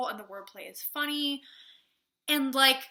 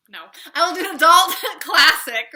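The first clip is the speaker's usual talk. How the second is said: louder, laughing through the fix; laughing correction; 6 semitones higher, 16 dB louder than usual. Real, not acted. Reviewer correcting her slip, laughing.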